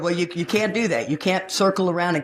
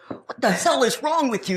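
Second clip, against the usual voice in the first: high-pitched retold indignation; appalled rebuff; 9 semitones higher than usual. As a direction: act out a shocked push-away. Acted rebuff after attempted kiss.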